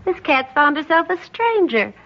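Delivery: amused mockery. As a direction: laugh at the newcomer. Laughs at the newcomer.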